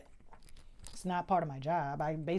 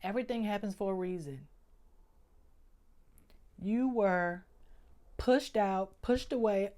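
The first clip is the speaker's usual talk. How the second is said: holding back tears; 6 dB quieter than usual. Real, not acted. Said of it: "I'm really about to cry right now".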